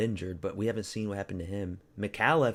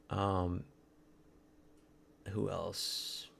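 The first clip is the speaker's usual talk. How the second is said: usual speaking voice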